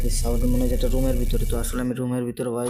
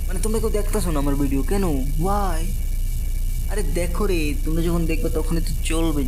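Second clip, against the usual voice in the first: higher, louder plea to viewers; pleading complaint; 5 semitones higher, 6 dB louder than usual. Real, not acted. Vlogger pleading with viewers to watch, raised complaining voice. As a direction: beg them to watch, half whining.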